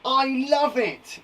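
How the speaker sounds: slow, high, drawn-out delight; excitement. Shown as a demonstration. Teacher showing exclamation marks for excitement, thrilled. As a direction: gush with excitement. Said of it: "it's exciting".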